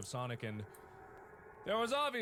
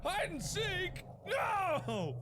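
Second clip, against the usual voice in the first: high, drawn-out cry of dismay; horrified yelp; much slower than usual. Real, not acted. Player facing a hide-and-seek threat, high horrified yell. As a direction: yelp it slowly in horrified disbelief.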